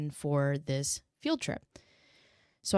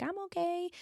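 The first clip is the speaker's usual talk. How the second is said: put-on much higher, softer little girl; a tiny child's quiet reply; small child voice; 13 semitones higher, 8 dB quieter than usual. Mother imitating her daughter saying she's okay. Voicing toddler softly.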